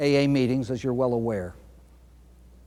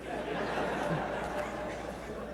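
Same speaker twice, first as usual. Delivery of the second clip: soft breathy laugh; 14 dB quieter than usual. Real, not acted. Speaker laughs at own joke.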